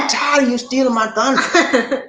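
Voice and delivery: high, drawn-out playful complaint; high-pitched, hammed-up grievance; mock exasperation. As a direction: whine it slowly in mock protest. Mock quarrel over stolen thunder.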